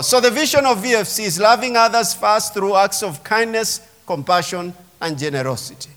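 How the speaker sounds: ordinary talk, nothing marked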